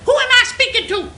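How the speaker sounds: high, loud, imperious caricature; mocked brusque tone. Manager answering the caller, high loud mock imperiousness. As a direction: demand it in an exaggerated voice. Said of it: "In that tone of voice".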